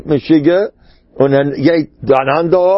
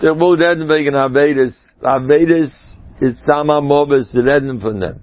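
usual speaking voice

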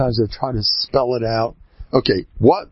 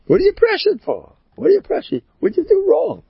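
much higher, loud disbelieving challenge; incredulous outburst; 4 dB louder than usual. Acted. Rabbi challenging a pressured listener, high incredulous cry. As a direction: fire the questions up high in disbelief.

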